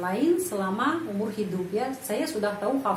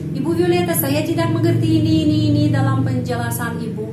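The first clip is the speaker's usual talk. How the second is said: higher, louder pleading student; confused student asking; 5 semitones higher, 6 dB louder, faster than usual. Pretend. Student asking Ibu Violeta for help, higher and louder. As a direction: play a puzzled student asking the teacher. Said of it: "bisa bertanya langsung".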